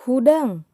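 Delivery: "hudang." barked high and loud with a falling end; command tone. Shown as a demonstration. Teacher demonstrating a command to pupils, loud and sharp. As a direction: snap out "hudang." as a sharp order. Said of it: "hudang itu kalimah parentah".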